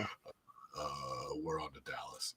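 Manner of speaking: very quiet, low, mumbled coach line; Belichick impression. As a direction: mutter it flat like a grumpy coach. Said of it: "you're so much better".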